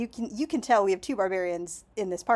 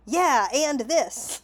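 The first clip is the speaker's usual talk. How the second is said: slow swagger; 5 semitones higher, 5 dB louder, much slower than usual. Shown as a demonstration. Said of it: "presenting yourself as if you're like".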